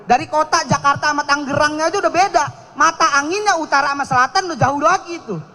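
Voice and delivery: usual speaking voice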